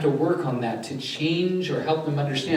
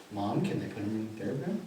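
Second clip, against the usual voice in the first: much softer, lower, careful question; gentle request; 4 semitones lower, 10 dB quieter than usual. Retold or imitated. Therapist checking with mom, hushed and gentle. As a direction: ask softly, as if checking with someone fragile.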